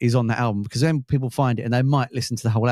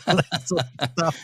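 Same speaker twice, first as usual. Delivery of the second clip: laughing; 6 semitones higher, slower than usual. Real, not acted. Laughing at his own joke.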